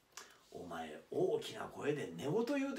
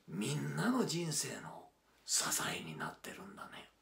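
hushed; 7 semitones lower, 6 dB quieter than usual. Acted. Hushed reflection on his fans.

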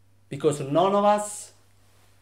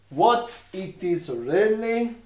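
ordinary delivery

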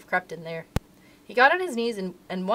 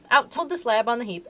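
ordinary delivery

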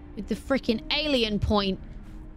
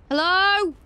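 calling out; 9 semitones higher, 6 dB louder than usual. Real, not acted. Calling out in the dark.

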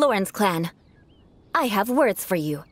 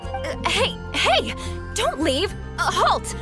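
high, urgent cries to halt; flustered calling out; 8 semitones higher, slower than usual. Acted. Noble calls after a departing townsman to halt, flustered calling out. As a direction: call after someone who is leaving, pitched high.

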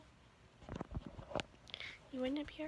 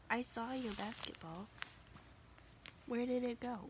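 higher, sing-song coaxing pet voice; baby talk to a dog; 4 semitones higher, 4 dB quieter than usual. Acted. Owner coaxing her dog Willow to find her toy, higher sing-song pet talk. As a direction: coax the puppy to fetch her toy.

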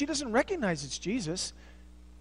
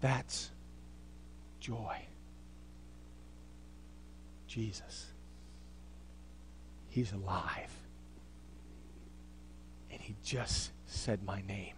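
much softer and lower, long pauses; near-silent awe; reverent hush; 10 semitones lower, 16 dB quieter than usual. Acted. Preacher letting the congregation sit in joy, hushed and low.

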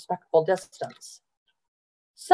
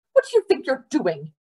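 higher, indignant demand; scolding mother; 6 semitones higher than usual. A narrator's reading. Mother storms up to the boy, scolding. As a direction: confront a misbehaving child sharply.

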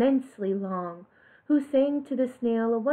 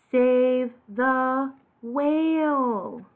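slow message; 2 semitones higher, much slower than usual. Acted. Message written by snail.

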